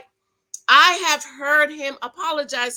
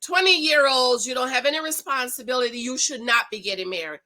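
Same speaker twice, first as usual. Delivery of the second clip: ordinary talk, nothing marked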